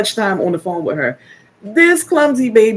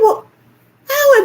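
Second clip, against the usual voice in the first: much higher, louder disbelieving "how?"; baffled how; 4 dB louder than usual. Retold or imitated. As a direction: pitch it way up in amused disbelief. Baffled mom after son falls.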